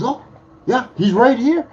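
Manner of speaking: loud, high and eager; cheery retold reply. Friend points him out to an eager fan, cheery and loud. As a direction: call it out brightly, imitating an obliging friend.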